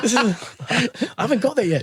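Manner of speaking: high, laughing mock protest. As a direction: push back through a laugh. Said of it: "I'm like".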